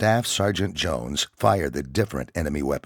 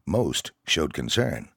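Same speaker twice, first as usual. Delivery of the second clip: usual speaking voice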